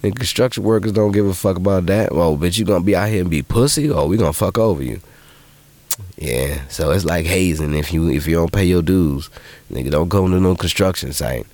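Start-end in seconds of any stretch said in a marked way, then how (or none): none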